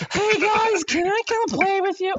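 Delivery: put-on a young boy's voice cracking as he asks to play; crackling kid voice. Meeting the clan, high squeaky kid voice. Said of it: "Crackling voice and everything".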